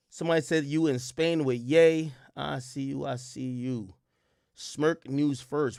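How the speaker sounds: usual speaking voice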